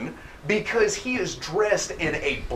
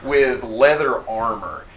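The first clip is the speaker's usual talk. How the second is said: ordinary talk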